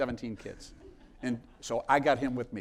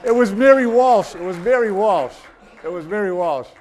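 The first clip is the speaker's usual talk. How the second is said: louder and higher, bursting with delight; gleeful punchline; 8 semitones higher, 13 dB louder than usual. Real, not acted. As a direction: crow the reveal three times, nearly laughing. Punchline of the story, laughing.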